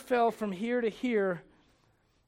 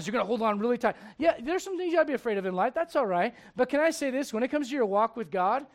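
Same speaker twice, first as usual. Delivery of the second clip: usual speaking voice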